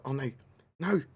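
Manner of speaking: normal talking voice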